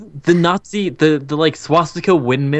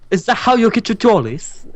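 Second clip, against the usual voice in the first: high, put-on British mimicry; terrible British accent; 7 semitones higher than usual. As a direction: echo it in a bad British voice, higher. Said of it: "all of our terrible British accents".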